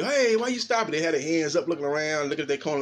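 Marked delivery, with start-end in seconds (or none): 0.0-0.9 high mock outrage at the stoppage; mock protesting fighter. Fighter faking protest to the referee, high and indignant. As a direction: squeal it like a fake complaint. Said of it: "They'll say".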